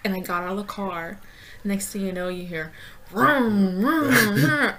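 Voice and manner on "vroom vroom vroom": engine vroom. Retold or imitated. Imitating car engine revving.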